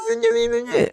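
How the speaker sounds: very high giggle still in the cartoon voice; squeaky laugh. Real, not acted. Laughing at her own impression, squeaky giggle. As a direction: laugh off the silly voice.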